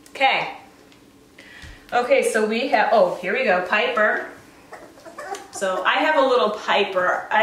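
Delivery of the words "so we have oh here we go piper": ordinary talk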